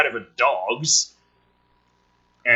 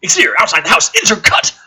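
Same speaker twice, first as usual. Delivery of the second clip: high, loud, shouting the cut; shouted direction; 9 semitones higher, 9 dB louder, faster than usual. Acted. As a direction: bark it like a director.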